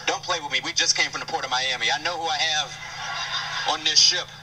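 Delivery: ordinary delivery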